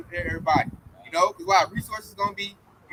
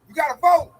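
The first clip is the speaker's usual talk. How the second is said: higher, louder nagging chant of the voting push; mocking get-out-the-vote preacher; mock voter urging; 5 semitones higher, 3 dB louder, much slower than usual. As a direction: nag them to vote like a campaigner. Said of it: "That's how I hear it".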